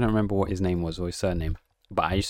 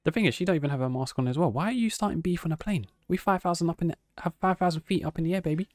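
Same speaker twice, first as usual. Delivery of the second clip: indignant; 9 semitones higher than usual. Real, not acted. Indignant reaction to video.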